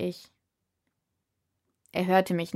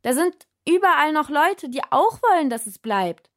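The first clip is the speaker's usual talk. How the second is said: much higher, louder protest; shouted; 8 semitones higher, 5 dB louder than usual. A narrator's reading. Insisting to the spirit over the noise, shouting. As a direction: call it out firmly.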